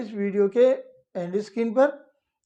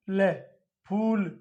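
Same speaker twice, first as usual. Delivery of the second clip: ordinary talk, nothing marked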